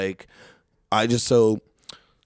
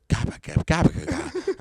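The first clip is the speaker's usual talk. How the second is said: put-on toddler babble. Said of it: "She says some gib".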